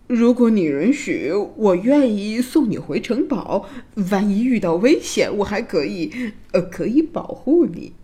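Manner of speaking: halting, hesitant suitor voice; stammering prince. A narrator's reading. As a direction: offer help haltingly, tripping over words. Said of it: "王子结结巴巴地说".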